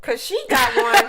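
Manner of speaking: real laughing outburst; laughing shout. Real, not acted. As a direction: crow it slowly through a big laugh. Gleefully echoing co-host's joke.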